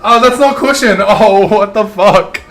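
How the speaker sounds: high, drawn-out pained groan. Real, not acted. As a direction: react to a sudden bump. Bumps into something, in pain.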